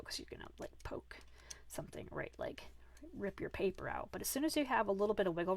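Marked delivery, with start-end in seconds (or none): 0.0-4.1 breathy whisper, much softer; whispered warning. Real, not acted. Warning against poking too hard.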